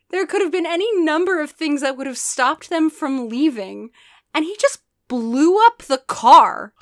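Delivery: loud, high disbelieving rant; incredulous rant. Real, not acted. Ranting about the explosion.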